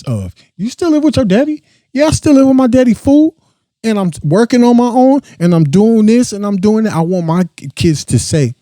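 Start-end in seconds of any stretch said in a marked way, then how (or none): 0.6-1.6 high squeaky mock voice of a taunting kid. Mocking his kids' future taunters in a high squeaky voice. Mocking someone who questions him. 1.9-6.9 high, fast, sassy mock voice; defensive reply. Voicing a grown kid defending living with dad, high and sassy.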